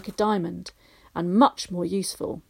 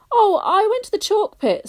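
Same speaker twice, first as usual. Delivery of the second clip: put-on higher, louder boy's voice; a boy breezily answering; Barney voice; 12 semitones higher, 7 dB louder than usual. A narrator's reading. Barney telling gran about the chalk pit, higher boyish voice.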